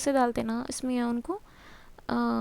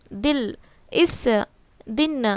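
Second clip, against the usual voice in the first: slow zer examples; much slower than usual. Shown as a demonstration. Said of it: "تو اس کو بولتے ہیں دل".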